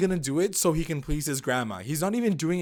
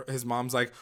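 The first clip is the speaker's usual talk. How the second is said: ordinary talk, nothing marked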